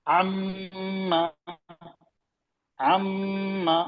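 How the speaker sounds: अम्मा with the मम held long in the nose; drawn-out nasal double m in अम्मा; ghunna on mm. Shown as a demonstration. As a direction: hold the म of अम्मा as a slow nasal hum. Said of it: "नून और मीम की आवाज नाक में थोड़ी देर हम रोक के रख रहे हैं".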